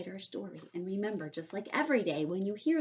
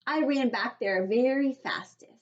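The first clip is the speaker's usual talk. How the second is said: put-on higher, louder little girl voice; Junie B. narrating; 4 semitones higher, 5 dB louder than usual. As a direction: read it as an excited kid.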